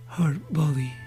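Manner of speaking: ordinary delivery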